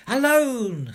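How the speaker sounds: high, loud gleeful screech. Acted. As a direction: shriek it out in triumph. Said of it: "screeched Pat".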